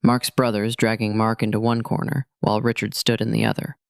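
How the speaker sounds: plain reading voice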